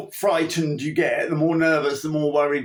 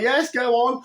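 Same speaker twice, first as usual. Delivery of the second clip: breezy go-ahead; 8 semitones higher than usual. Retold or imitated. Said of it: "It's like".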